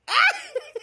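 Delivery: high squealing burst of laughter. Real, not acted. [name] laughs as the caller hangs up, high squeal.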